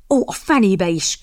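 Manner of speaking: exasperated, groaned curse; groaning. A narrator's reading. Captain sees the drawn pistol, groaning. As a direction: moan it in dismay. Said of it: "nyögött Hinsley".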